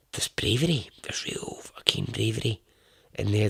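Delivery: hushed whisper. Real, not acted. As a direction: drop to an intense whisper. Emphatic hushed praise of bravery.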